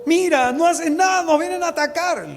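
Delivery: panicked servant. Acted. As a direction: cry out in alarm to the prophet. Servant panics before an army.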